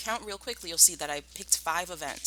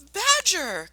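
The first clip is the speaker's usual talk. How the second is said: much higher, louder glad shout of recognition; excited cry; 7 dB louder than usual. Acted. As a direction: call out his old friend's name in surprise. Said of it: "cried Mister Fox".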